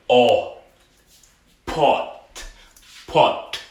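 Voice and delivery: O as "aw", then "Pot" as pawt; open O demo. Shown as a demonstration. Teacher isolates the vowel then the word, O and "Pot" with open "aw". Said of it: "That's our vowel right here".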